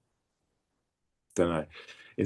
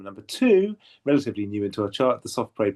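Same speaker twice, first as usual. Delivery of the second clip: chart rundown. Pretend. Host reveals second place on the leaderboard, chart rundown style. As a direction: announce the runner-up like a chart DJ. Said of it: "correct sort of chart rundown style".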